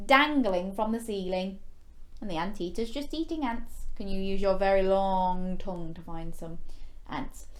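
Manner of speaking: ordinary delivery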